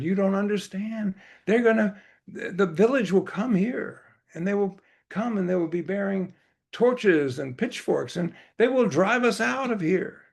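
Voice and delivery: high-pitched, anxious warning; Baba. Acted. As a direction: warn them urgently, acting out alarm.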